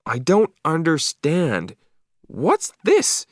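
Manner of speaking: puzzled dog voice. A narrator's reading. Marley the dog puzzled by his first snow, high wondering voice. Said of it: "I could read his thoughts".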